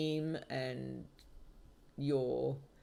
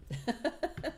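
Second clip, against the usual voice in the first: a quick high-pitched giggle; short amused giggle, much higher than speech; 11 semitones higher than usual. Real, not acted. Laughing at Jupiter boast.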